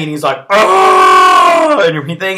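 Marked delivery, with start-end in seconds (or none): 0.5-1.8 a much higher, loud scream. Retold or imitated. Saru screaming back at Tarek, high loud scream. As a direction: belt out a sharp scream. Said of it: "Scream back at me".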